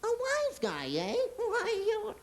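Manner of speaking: Three Stooges horn. Retold or imitated. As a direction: squeal it like Moe from the Stooges. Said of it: "I hit the horn".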